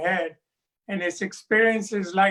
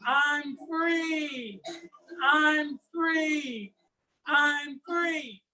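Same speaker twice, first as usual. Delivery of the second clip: higher, joyful cry of freedom; cries out in joy; 7 semitones higher, 3 dB quieter, much slower than usual. Acted. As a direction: let the snake exult, lifting each time. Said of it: "the snake cries out".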